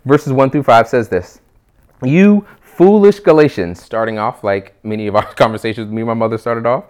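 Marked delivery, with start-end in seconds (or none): 2.0-3.8 slow, loud, higher-pitched rebuke; impassioned frustration. Acted. Paul rebuking the Galatians out of love, impassioned and frustrated. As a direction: rebuke them loudly from loving anger. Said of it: "Do you hear the frustration".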